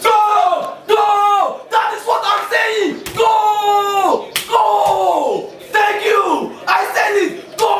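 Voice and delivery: excited shouting. Real, not acted. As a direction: roar the team forward, voice up high. Husband cheering the attack toward a goal.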